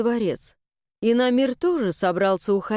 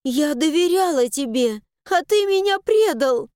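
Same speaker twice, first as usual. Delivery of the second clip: from hurt to a high cry; growing louder; 5 semitones higher than usual. Acted. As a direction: build to a furious cry on ты. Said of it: "с каждым словом голос её становился громче".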